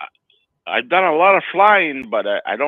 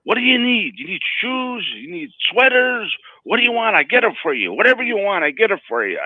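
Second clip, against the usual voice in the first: woman hawking goods; 7 semitones higher than usual. Retold or imitated. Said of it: "She goes".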